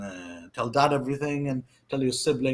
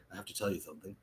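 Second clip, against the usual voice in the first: hushed secret; 7 semitones lower, 17 dB quieter, much faster than usual. Retold or imitated. Telling brother a secret.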